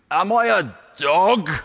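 booming, loud giant's roar; Brian Blessed. Retold or imitated. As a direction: bellow it like a giant warrior. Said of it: "that was a very good impression".

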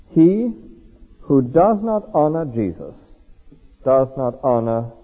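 each phrase spaced out slowly; slow deliberate reading. Shown as a demonstration. Preacher reading slowly to the congregation, deliberate and spaced. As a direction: read it slow for the children. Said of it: "whose six-year-old couldn't understand that".

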